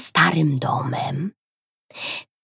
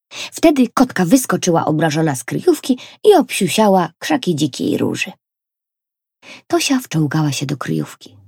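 usual speaking voice

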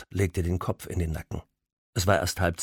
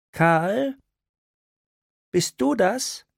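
put-on higher, surprised older woman; a mother calling her grown son; mother's voice; 14 semitones higher, 4 dB louder, much slower than usual. Mother calls on the phone.

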